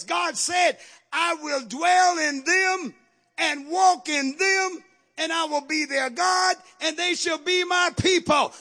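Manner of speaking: high, shouted sermon in driving phrases; full-force preaching. Real, not acted. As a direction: proclaim it loudly to the whole congregation.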